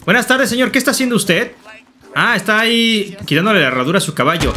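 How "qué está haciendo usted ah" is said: ordinary delivery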